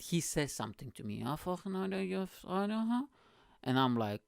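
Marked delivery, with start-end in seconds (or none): 1.2-3.0 high-pitched mock Scouse mumble; garbled Scouse imitation, rising at the end; Scouse accent. Bus passenger accosting the storyteller, in a high, garbled Scouse accent. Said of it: "with a very Scouse accent".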